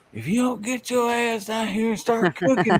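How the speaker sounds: high, sharp mock scolding; mock scolding wife. Wife yelling up to him from the pool to come cook. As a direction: bark it like a fed-up wife. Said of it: "then she said".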